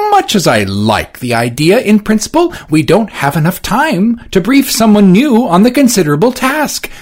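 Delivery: high, loud strained politeness; prim Johnson. Acted. Johnson primly answering Orsino. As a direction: hold back irritation politely.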